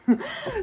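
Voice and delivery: a quick high giggle; high nervous laugh. Real, not acted. Nervous laugh before holding back a secret. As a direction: laugh off the awkward pause.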